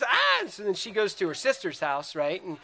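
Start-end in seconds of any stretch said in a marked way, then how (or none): 0.0-0.4 short, very high, loud shriek; mock frustrated scream. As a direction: act out an exasperated scream. Wife's frustrated scream in quarrel.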